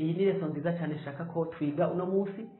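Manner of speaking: ordinary talk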